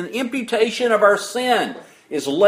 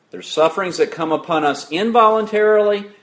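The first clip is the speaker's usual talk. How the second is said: ordinary delivery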